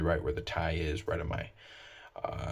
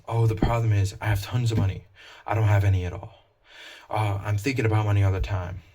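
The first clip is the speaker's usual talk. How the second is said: mock fretting voice, pitched up and louder; an exaggerated worrier talking about money; money-obsessed person; 3 semitones higher, 6 dB louder than usual. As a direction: play someone who can't stop thinking about money. Voicing people fretting about money.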